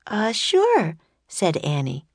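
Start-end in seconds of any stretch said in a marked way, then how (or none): 0.1-1.0 put-on higher, hesitant young girl. A narrator's reading. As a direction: voice Annie as unsure. Annie voiced by narrator.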